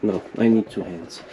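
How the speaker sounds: ordinary talk, nothing marked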